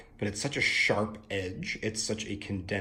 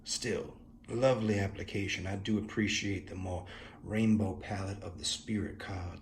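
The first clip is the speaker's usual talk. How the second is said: ordinary talk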